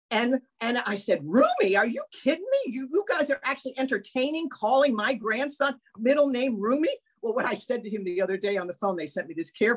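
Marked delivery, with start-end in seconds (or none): 1.2-7.0 incredulous. Retold or imitated. Retold incredulous reaction to the name.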